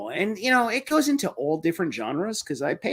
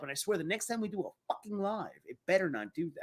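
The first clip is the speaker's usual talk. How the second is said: ordinary talk, nothing marked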